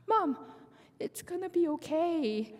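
high little voice fighting tears; imitated child holding back tears. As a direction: play a small child comforting mom, nearly crying. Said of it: "she tried not to cry".

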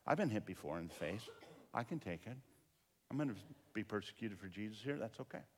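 low, soft self-talk, quickening; hushed resolve. Retold or imitated. Pastor bracing himself for the punch, hushed. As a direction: steel yourself under your breath. Inner resolve before being hit.

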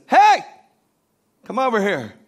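piercing high shout, then beckoning; bird calling out. Acted. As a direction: holler "hey" loud and high at a passerby. Bird calls man a second time.

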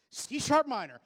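high, loud shout of C sharp minor; preacher shouting the only chord he knows; comic shout. Acted. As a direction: belt it out as the punchline. Comic punchline on repeated chord.

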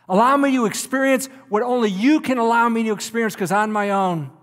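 loud, high, impassioned preaching; fiery preaching. Acted. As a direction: preach it out with full force, pleading. Preacher at full force.

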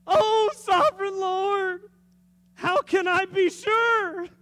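high, loud, slow pleading voice; pleading Abraham. Acted. Abraham crying out to God, high and slow pleading. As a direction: stretch it out like a begging old man.

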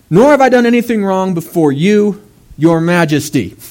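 pointed retort. Retold or imitated. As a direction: stress your majesty with cheeky politeness. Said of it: "He says".

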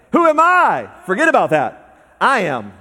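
loud, high fervent preaching; preaching at full force. Acted. Preacher answering doubt with a loud fervent cry. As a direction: hammer the answer home. Call and response, shouted.

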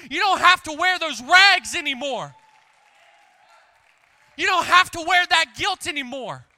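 loud, high, pounding sermon; shouted preaching climax. Acted. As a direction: build to a shout over the congregation.

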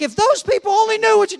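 high, loud indignant cry; exasperated outburst. Retold or imitated. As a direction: burst out like a wronged kid. Kid exasperated at dad's switch.

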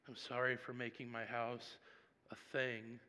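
soft, hushed apology; quiet apology. Retold or imitated. Pastor apologizing to God, soft and humbled. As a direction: say sorry gently, humbled.

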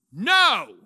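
one sudden loud, high shout; yelled. Retold or imitated. The Lord to him off the front porch, yelled. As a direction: yell it like a thundering command. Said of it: "He yelled at me".

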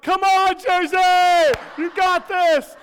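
very loud, high sideline bellow; full-volume cheering. Retold or imitated. Uncle ignoring crying child to cheer niece, high loud shout. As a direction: yell it across the field, re-enacting a fan.

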